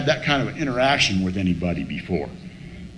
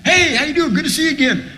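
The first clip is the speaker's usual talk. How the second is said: high-pitched, over-friendly hello; eager greeting; 11 semitones higher than usual. Retold or imitated. Meeting regular greets the newcomer again, eager greeting. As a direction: imitate a chirpy pal greeting someone.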